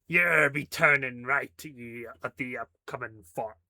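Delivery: slow, hammy pirate voice; pirate accent. Acted. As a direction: growl it out like an old pirate. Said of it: "That's more like it".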